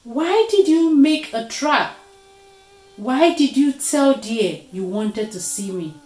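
loud, high demand easing toward the end; angry confrontation. A narrator's reading. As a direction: demand an explanation. Leopard angrily confronts Spider.

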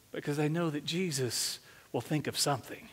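usual speaking voice

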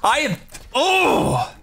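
slow, strained furious screams; furious shouting. Real, not acted. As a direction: yell in real rage at the screen. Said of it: "that actually genuinely made me fucking angry".

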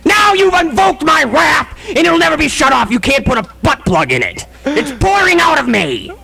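mock wrath, roar it out; feigned yelling. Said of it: "That's called me yelling".

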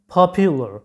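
popular said with a "pyu" glide, pop-yu-lar; correct pronunciation. Shown as a demonstration. Teacher introduces the first word to students, correct pronunciation. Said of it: "সঠিক উচ্চারণটা হচ্ছে".

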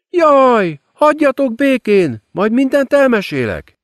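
high, silky demon queen mocking her victim; gloating demoness. A narrator's reading. Demon queen gloating over the ruined girl, silky and high. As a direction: savour every cruel word, sweetly. Lilith playing Tess.

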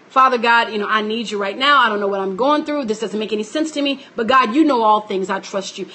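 pastor models a simple prayer, low and hushed. Shown as a demonstration. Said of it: "Simply to say".

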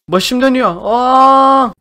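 a shout of alarm as the world spins; dizzy panic. Acted. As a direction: sell the spinning head. Game effect makes him dizzy.

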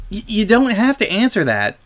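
high, stammered reassurance; nervous backpedalling. A narrator's reading. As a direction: back off with a small stutter. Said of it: "afraid further questioning might release more tears".

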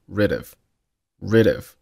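"rid of" run together, d sliding into the vowel; "rid of" with no break between the words; linked reading. Shown as a demonstration. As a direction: link "rid of" as one word. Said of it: "发成辅元连读".

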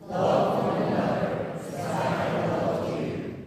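usual speaking voice

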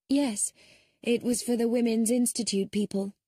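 quick prim reply; brisk woman. Acted. Emma speaks.

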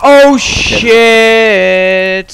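loud, high alarmed yell; startled shout. Real, not acted. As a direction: blurt it in shock. Startled by warden.

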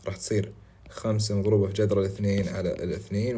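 ordinary talk

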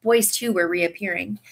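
ordinary delivery

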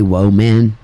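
woman split into "Whoa, man"; long O in woman, like whoa; country pronunciation of woman. Shown as a demonstration. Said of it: "Or I say".

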